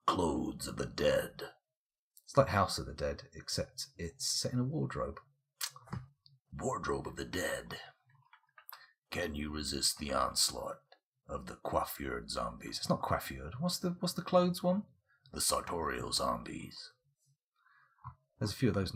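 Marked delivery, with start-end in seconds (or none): 0.1-1.4 low, slow horror title voice; spooky trailer voice. Pretend. Spoofing a horror game title. 6.6-7.8 low, slow horror whisper; spooky whisper. Pretend. Spoofing a horror game title. 9.1-12.8 low, soft horror trailer voice. Pretend. 15.3-16.9 low, soft horror trailer voice. Pretend. Horror trailer spoof again.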